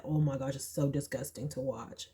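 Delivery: ordinary delivery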